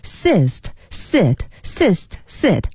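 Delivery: "Sist，sit，sist，sit" very slowly, each word set apart; sound-alike comparison. Shown as a demonstration. Co-host testing how "sist" resembles "sit", slow separated syllables. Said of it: "它有点像sit".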